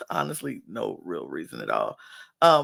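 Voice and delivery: ordinary delivery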